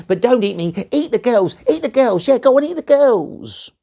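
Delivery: high, loud frantic begging; pleading gnome. Acted. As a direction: grovel desperately to save himself. Gnome begs bear for life.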